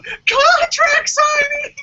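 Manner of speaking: high, slow, hammed-up announcer call; over-the-top fake-positive announcement; mock hyped announcer. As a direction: belt it out like a cheesy hype man. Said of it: "it's just me going".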